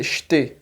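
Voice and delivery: "ste" said "shte"; Northeastern hushed S. Shown as a demonstration. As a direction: turn the S of "ste" into SH before the T. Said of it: "no Nordeste a gente tem o costume".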